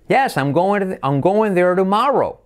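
high YES, swooping up on "tomorrow", then down; affirmative intonation. Shown as a demonstration. Teacher models affirmative, high swooping tune. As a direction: pitch YES high, slowly swing TOMORROW. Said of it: "me dejaba la afirmativa".

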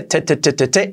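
"te" repeated with a hard, puffed t; strong T drill. Shown as a demonstration. Teacher drilling the strong t in "te". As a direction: fire off "te" with a strong burst. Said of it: "La T bien fuerte".

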